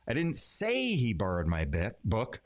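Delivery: "say" punched and drawn out; stress on SAY. Shown as a demonstration. Teacher showing how emphasis changes meaning, stress on "say". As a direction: hit the word "say". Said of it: "putting emphasis on different words".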